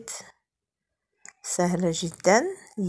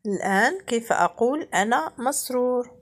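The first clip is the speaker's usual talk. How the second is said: ordinary talk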